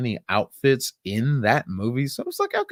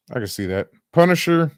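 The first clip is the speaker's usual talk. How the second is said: ordinary delivery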